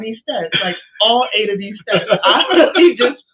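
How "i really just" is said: laughing. Real, not acted. Laughing recalling the fall.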